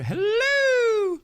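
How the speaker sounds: high, loud sing-song hello; hammed-up high "Hello"; falsetto hello. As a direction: squeal the greeting way up high. Greeting a viewer playfully.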